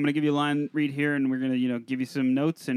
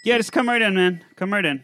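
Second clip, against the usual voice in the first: louder, higher call across the room; calling someone in; 6 semitones higher, 7 dB louder than usual. Pretend. Casting director summoning an auditioner, calling out. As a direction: beckon an actor in with a raised voice.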